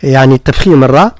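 ordinary talk

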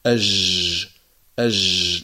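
أج خاطئة; wrong fricative jeem. Shown as a demonstration. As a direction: demonstrate the faulty hissing أج. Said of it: "لا نقول أج".

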